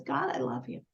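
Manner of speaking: low, soft and tender, like a whispered prayer; hushed prayer. Retold or imitated. A grieving mother quietly telling God she loves him. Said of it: "And she says".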